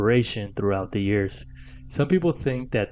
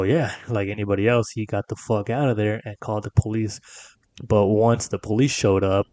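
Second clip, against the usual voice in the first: usual speaking voice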